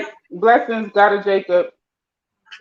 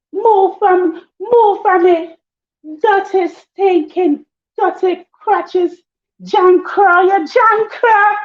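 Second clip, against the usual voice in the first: very high, drawn-out mimicked insults; imitated sweet cussing; 13 semitones higher, 3 dB louder, much slower than usual. Showing her English tone while cussing, imitated high and sweet. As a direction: stretch the insults slowly in a high put-on voice. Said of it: "It just come together like".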